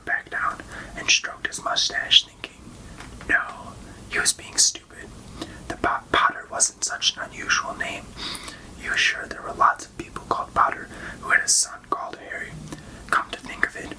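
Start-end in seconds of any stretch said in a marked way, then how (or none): none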